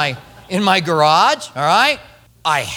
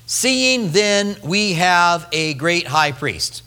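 slow reading; much slower than usual. Shown as a demonstration. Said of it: "I've got one word highlighted".

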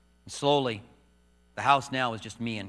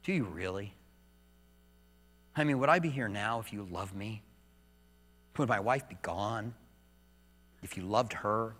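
grieving question; 5 semitones lower, 7 dB quieter than usual. Retold or imitated. Questioning God in grief.

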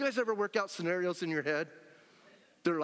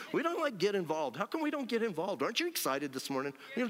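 normal talking voice

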